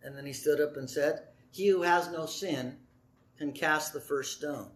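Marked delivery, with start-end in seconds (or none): 1.5-4.7 low and soft, unhurried; calm word that disarms; softly, without raising the voice; gentle quiet answer. Acted. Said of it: "I don't think he raised his voice".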